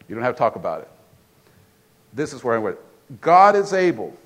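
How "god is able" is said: "God is able." declared loud with GOD hit hard; "God is able." thundered to the crowd; "God is able." full preaching force; proclaimed. Shown as a demonstration. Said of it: "Everybody say".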